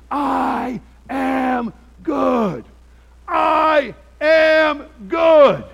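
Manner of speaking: very slow, high, loud shout, each word stretched; retold furious yell. Preacher acting out the officer yelling on his lawn, drawn-out shout. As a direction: bellow it like the angry man on his lawn. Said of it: "And he was yelling".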